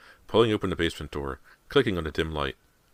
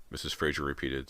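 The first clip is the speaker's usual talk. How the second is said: usual speaking voice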